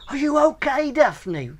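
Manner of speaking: lightly voiced worried girl, called out; dream girl's voice.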